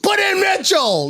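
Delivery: hammed-up sports yell; mock shout. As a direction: bellow it like a call from the stands. Calling out the rule.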